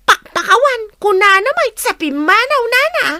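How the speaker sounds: mock huffy retort, pitched high; imitating her reply. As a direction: parrot her huffy answer. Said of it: "anya nga iti insungbat na".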